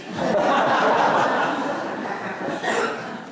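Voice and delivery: long breathy laughter; hearty laugh. Real, not acted. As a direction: laugh out loud at the joke. Laughs at own joke.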